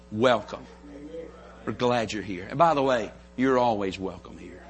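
soft, low, reassuring greeting; gentle welcome. Acted. Church member welcomes someone hurting, low soft welcome. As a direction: welcome them in quietly and tenderly.

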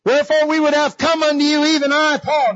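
high, forceful pulpit reading; declaimed scripture. Acted. Preacher reading Paul's words to church, high declaiming.

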